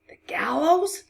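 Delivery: horrified whisper rising high. A narrator's reading. Man whispering in dread of the gallows, whisper. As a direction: gasp it as a rising whispered question. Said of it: "She heard him whisper again".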